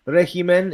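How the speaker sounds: "regimen" said the Spanish way, reh-hee-men; Spanish pronunciation. Shown as a demonstration. Giving the Spanish word, "regimen" as reh-hee-men. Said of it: "is the Spanish".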